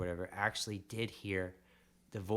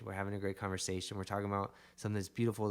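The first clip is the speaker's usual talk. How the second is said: usual speaking voice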